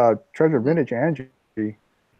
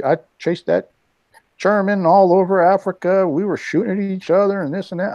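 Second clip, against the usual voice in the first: put-on higher, lively retelling of an old soldier's boast; a light echo of a proud old vet; old veteran bragging; 6 semitones higher than usual. Retelling a veteran's war story, lightly voiced.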